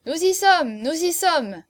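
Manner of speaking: high, loud answering call; rumbling chorus. A narrator's reading. The band of revellers answers the marquis, loud chorus. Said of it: "gronda le chœur des exécutants".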